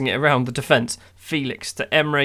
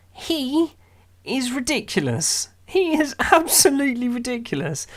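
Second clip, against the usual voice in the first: much higher, laughing disbelief; amazed laughing praise; 10 semitones higher than usual. Real, not acted. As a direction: gush about a player too good to believe. Marvelling at star striker.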